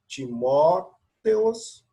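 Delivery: Timóteos drawn out slowly, each syllable clear; slow syllable reading. Shown as a demonstration. Teacher modelling Greek pronunciation slowly. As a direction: read Timóteos slowly for students. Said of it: "Então, Paulos".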